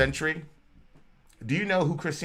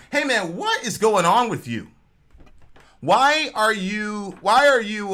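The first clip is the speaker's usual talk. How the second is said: higher-pitched, emphatic questioning; bewildered soldier; 7 semitones higher, 4 dB louder, slower than usual. Acted. Roman soldier asks the man who helps him, baffled. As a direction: act out mild exasperated disbelief.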